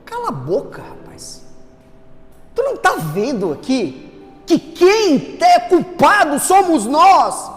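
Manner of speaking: repreensão alta e aguda, lenta. Retold or imitated. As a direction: repreenda com firmeza. Ladrão repreende o outro. (ladrão repreendendo o outro, alto e firme)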